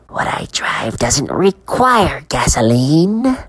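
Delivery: slow eerie whisper. A narrator's reading. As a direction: breathe it close to his face. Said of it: "leaned in low".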